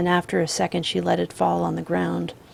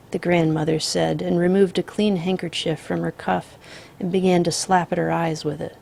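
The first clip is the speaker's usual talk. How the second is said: plain reading voice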